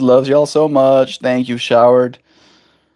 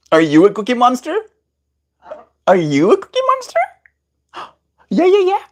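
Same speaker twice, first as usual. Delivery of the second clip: talking to a pet; 11 semitones higher, faster than usual. Real, not acted. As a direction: chirp it at the bird. Baby talk to the bird.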